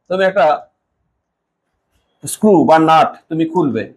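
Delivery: ordinary talk, nothing marked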